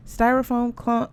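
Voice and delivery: ordinary delivery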